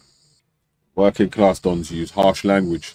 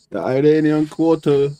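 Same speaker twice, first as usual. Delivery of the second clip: mock Iranian accent; 7 semitones higher than usual. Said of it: "in his Iranian accent".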